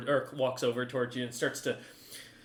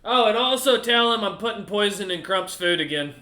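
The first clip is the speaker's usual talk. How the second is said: loud, higher parting jab; grumbling cook; 5 semitones higher, 7 dB louder than usual. Acted. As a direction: call out one last spiteful message. Cook shouts a parting threat.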